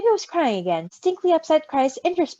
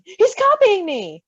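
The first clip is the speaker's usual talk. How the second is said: elated, drawn-out and loud; joyful cry; 8 semitones higher, 6 dB louder, slower than usual. A narrator's reading. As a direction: burst out proud and happy. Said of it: "Roman's joy".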